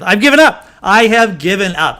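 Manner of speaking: loud, high outburst of giving up; emphatic, worked-up admission; exasperated surrender. Real, not acted. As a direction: throw up your hands and declare defeat loudly. Declares defeat over pronouncing her name.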